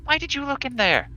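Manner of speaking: very high scolding female voice; scolding woman. Acted. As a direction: demand it, hands-on-hips indignation. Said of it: "puts her hands on her hips and goes".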